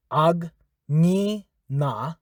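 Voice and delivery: "agni na" drawn out, syllable by syllable; slow syllables. Shown as a demonstration. Teacher slows word down for learners, drawn out. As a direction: stretch "agni na" slowly. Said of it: "let me help you out".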